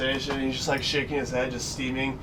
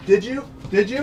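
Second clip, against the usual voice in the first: higher, louder, mocking challenge; challenging taunt; 7 semitones higher, 4 dB louder than usual. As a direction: taunt him while stepping forward. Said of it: "He goes".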